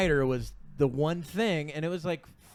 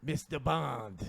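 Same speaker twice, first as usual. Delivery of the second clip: Bond villain voice; 4 semitones lower, 8 dB quieter, much slower than usual. Retold or imitated.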